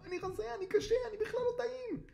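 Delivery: very high, squealing plea; panicked cat at top pitch; crying out. A narrator's reading. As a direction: squeal desperately for your life. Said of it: "קרמר קרא".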